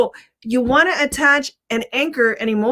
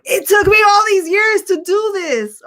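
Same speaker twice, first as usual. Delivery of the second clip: high, raised-voice outburst; worked-up, pitched-up exclamation; impassioned screaming; 8 semitones higher, 3 dB louder than usual. Real, not acted. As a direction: cry it out with real frustration. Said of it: "I'm, like, screaming at, uh, screaming it".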